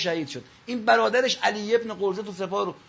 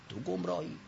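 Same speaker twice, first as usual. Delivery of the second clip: ordinary delivery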